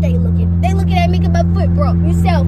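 loud back-and-forth yelling; loud bickering in a car; heated argument. Acted. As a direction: raise your voice and argue with him.

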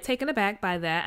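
normal talking voice

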